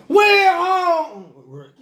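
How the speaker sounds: high, loud, slow crying wail on "We hungry", "We" dragged out; loud wailing plea. Retold or imitated. Showing how the boys really beg, loud slow wail. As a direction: stick your head out and wail "We hungry". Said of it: "You gotta stick your head out like".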